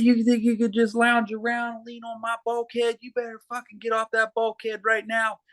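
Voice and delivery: retold recruit-camp screaming, pitched high; drill instructor yelling. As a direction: bark it down the line like a boot camp instructor. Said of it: "he said".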